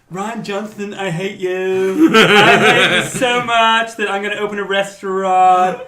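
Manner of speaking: slow, loud mock Trump voice; hammy Trump drawl; Donald Trump impression. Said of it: "I'm sounding a little bit like Donald Trump".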